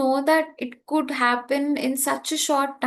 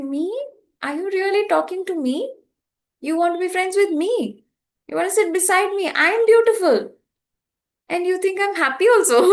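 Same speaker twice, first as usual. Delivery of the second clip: higher, amused disbelief, half laughing; amazed disbelief; 8 semitones higher, faster than usual. Retold or imitated. Disbelief at sudden praise.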